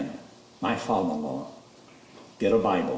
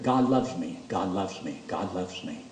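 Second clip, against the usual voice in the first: repeated, dropping softer each time; fading awe; 5 semitones lower, 5 dB quieter, slower than usual. Real, not acted. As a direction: let it fade into wonder. Awestruck repetition, fading away.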